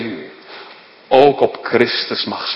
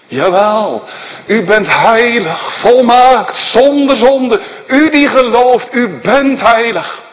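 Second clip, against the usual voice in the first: louder and higher, hammering each word from the pulpit; fervent preaching; 8 semitones higher, 7 dB louder than usual. Acted. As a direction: proclaim holiness to the flock with full force. Preaching at full force.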